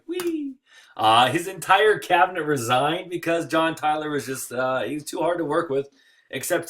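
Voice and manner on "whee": high, thin "whee"; high-pitched token cheer; mock cheer. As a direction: squeal a little mock-celebratory whee. Mock cheer for dubious feat.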